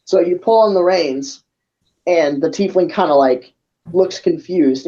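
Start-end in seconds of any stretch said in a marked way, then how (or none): none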